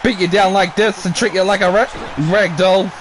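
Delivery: play-by-play call. Acted. Commentator urging Disco Inferno to fight back, excited play-by-play. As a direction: cheer the wrestlers on like a ringside announcer.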